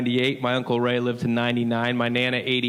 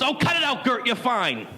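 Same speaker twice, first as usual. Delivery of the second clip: higher, brisk scolding aunt; impatient scolding; 9 semitones higher than usual. Retold or imitated. Aunt scolding her screaming sister, impatient scolding. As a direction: brush off the fuss sharply. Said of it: "Yells at her".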